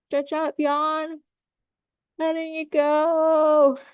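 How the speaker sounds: high, drawn-out release guidance, slow on the long words; lifted release call. Acted. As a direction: coax the group to let it all go. Leads a release with yawning.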